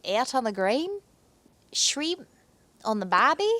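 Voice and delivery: lightly faked Aussie accent, rising; Australian accent. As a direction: ham up an Aussie phrase. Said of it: "I literally can't say anything else".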